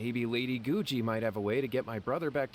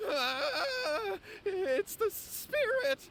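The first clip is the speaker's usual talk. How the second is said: high, trembling fright; terrified cry; much slower than usual. Acted. Client sees the spirit appear, terrified. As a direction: voice breaking in terror. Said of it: "Some great acting".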